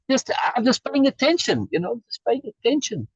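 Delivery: airy high voice, lightly playful; mock distracted voice. Teacher mocking a distracted self, airy high voice. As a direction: float the words up high and dreamy.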